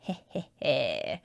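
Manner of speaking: short muffled snicker; stifle a little laugh. Real, not acted. Laughs at own joke.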